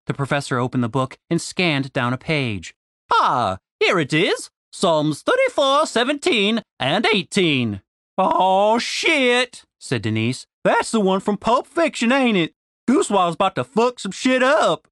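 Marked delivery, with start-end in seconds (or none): 3.1-7.8 put-on old professor voice. A narrator's reading. Professor reading scripture. 8.2-14.9 Southern woman voice. A narrator's reading. Denise character voice.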